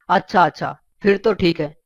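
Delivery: pompous chief minister. Acted. Chief minister phones Billa about the neighbour, official and low. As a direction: speak like a minister lecturing a citizen.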